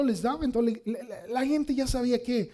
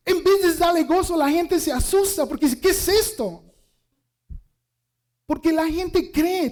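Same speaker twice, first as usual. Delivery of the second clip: louder, higher preaching voice; 4 semitones higher, 5 dB louder than usual. Acted. Pastor telling the church about the miracle, louder and higher. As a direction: drive the point home to the crowd.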